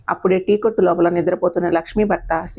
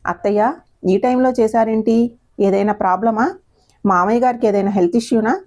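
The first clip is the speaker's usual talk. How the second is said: ordinary delivery, nothing marked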